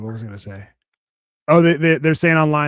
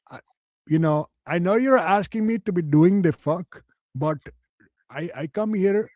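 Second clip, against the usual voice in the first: mock Indian accent; 3 semitones higher than usual. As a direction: ham up a flustered Indian employee's accent. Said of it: "and he's all".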